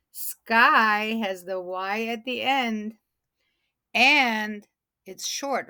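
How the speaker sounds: ordinary delivery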